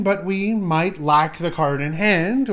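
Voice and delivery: normal talking voice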